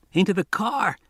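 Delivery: put-on high, scratchy boy's voice over a radio; urgent scratchy radio voice. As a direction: pitch it up and scratchy, like a kid on a crackling radio. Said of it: "said a scratchy voice".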